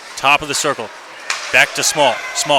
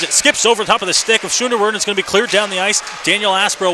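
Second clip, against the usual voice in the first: rising excitement; 7 semitones higher, faster than usual. Real, not acted. Fast action in live play-by-play.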